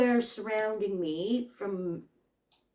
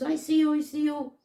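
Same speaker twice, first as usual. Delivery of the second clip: calling out; 6 semitones higher, 4 dB louder than usual. Retold or imitated. Retold driver calling out on phone.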